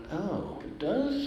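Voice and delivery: high, soft, drawn-out retold question; mock innocence. Music director being corrected by Ray, feigned soft innocence. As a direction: play innocent, softly and slowly.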